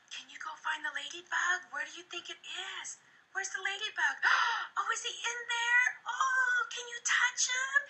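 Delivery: put-on high, excited sing-song voice for a small child; talking to a toddler. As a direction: coax the kid to find the ladybug with big gasps. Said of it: "I'll go".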